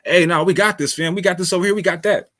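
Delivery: high-pitched imitation of someone proclaiming it; mock boastful yell. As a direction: mimic a claim shouted from treetops, only half yelled. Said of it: "screaming from the treetops and the mountaintops".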